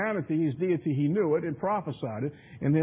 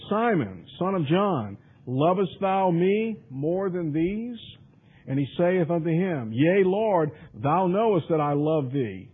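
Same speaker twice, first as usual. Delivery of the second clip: loud, emphatic Bible reading; drawn-out, declaimed King James verse; scripture reading; 2 semitones higher, 5 dB louder, slower than usual. Acted. Preacher reads Jesus questioning Peter, loud scripture reading.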